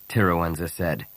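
plain reading voice

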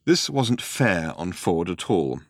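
plain reading voice

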